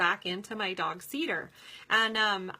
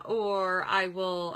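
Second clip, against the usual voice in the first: ordinary talk, nothing marked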